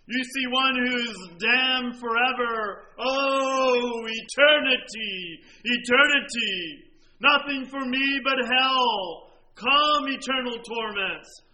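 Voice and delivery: loud, slow, anguished cry of a dying infidel; dying man's cry. Retold or imitated. Preacher voicing a dying infidel's despair, loud and slow. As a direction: cry it out as the damned man. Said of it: "he said".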